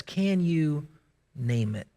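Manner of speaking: low, hushed, slowed question; quiet repeat. Shown as a demonstration. Preacher asking the congregation again, hushed. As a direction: let it sink in softly.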